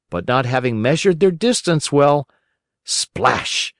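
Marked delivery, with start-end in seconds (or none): none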